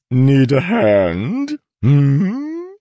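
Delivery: bright, drawn-out cheery offer; peppy. Acted. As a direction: sound eager and playful. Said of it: "a peppy voice spoke".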